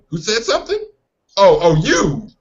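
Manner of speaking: a hint of a stern parent walking in. Parent storming in on the kids, mock scolding.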